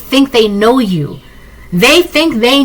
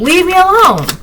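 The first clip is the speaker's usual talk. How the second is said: yelling; 9 semitones higher than usual. Shown as a demonstration. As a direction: yell it so everyone looks. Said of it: "I'll yell if I have to".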